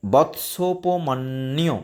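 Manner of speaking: slow বৎসোপমন্যু, each part spoken separately; slow, careful Sanskrit reading of বৎসোপমন্যু; split pronunciation. Shown as a demonstration. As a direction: break বৎসোপমন্যু into its parts, slowly. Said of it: "ভেঙে দিয়েছি দেখো".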